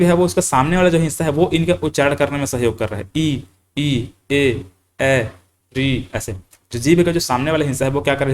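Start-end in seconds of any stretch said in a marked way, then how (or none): 3.2-5.3 "ई" and "ए" each said twice, lips spread; front vowels demonstrated. Shown as a demonstration. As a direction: show the class "ई, ई, ए, ए" with tongue forward. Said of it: "ई में बोल रहा हूँ". ("ई, ई, ए, ए" अग्र स्वर का प्रदर्शन)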